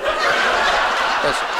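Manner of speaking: breathy laughing repeat; said through laughter. Shown as a demonstration. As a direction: let it dissolve into a laugh.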